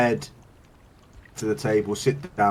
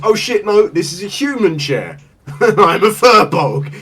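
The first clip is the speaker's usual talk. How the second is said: loud, higher dismayed gasp of realization; sudden realization; 8 semitones higher, 8 dB louder than usual. Acted. Drunk furbolg realizing the chair is human, loud and alarmed. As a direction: catch yourself in loud alarm. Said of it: "you realize".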